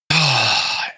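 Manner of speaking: long, voiced sigh of relief; relieved sigh. Real, not acted. Fan relieved to finally see a win, big sigh.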